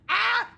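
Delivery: loud high yelp; sharp cry. Real, not acted. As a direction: let out a short yelp. Outburst after laughing.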